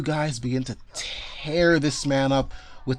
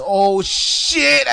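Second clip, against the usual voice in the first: sudden loud high yelp; alarmed enemy; 6 semitones higher, 7 dB louder than usual. Acted. Game master voicing enemy getting sliced up, loud yelp. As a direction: blurt it as the blades hit. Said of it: "And he says".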